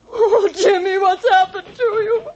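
high-pitched tearful wail; distraught cry. Acted. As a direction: cry out in distress for someone missing. Frightened woman cries out for Jimmy.

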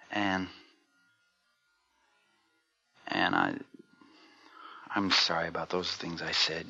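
very low, soft and hesitant; tender apology. Acted. Husband makes up with wife after quarrel, tender apology. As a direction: apologise quietly, with pauses.

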